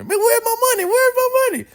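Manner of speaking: high-pitched imitation of an upset boy; squealed, hammed-up complaint; mock frantic demand. Angry friend demanding his money back.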